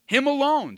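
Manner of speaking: "Him alone" loud and high throughout; emphatic "Him alone". Shown as a demonstration. Preacher driving home exclusive worship, "Him alone" high and loud. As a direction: punch out "Him alone" forcefully. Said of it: "See, the emphasis is".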